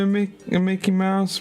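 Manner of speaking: very high cartoon mouse voice; high-pitched cartoon mouse impression; Mickey Mouse. Shown as a demonstration. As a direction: jump up into a squeaky mouse falsetto. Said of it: "I can kind of do".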